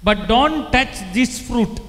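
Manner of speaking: slow, loud voice of God; preacher voicing God's warning to Adam and Eve; God's command. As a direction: lay down the one rule as God. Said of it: "and he said".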